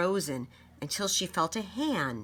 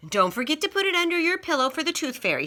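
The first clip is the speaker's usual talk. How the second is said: put-on high, quick, eager reminder from an older girl; bright, hurried big-sister reminder; 11 semitones higher, 5 dB louder, faster than usual. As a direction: rattle it off high and fast. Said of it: "Susan reminded Elise".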